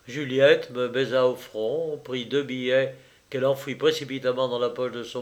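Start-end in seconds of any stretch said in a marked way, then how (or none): none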